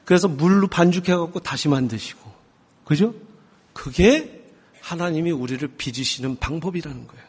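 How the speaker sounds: ordinary delivery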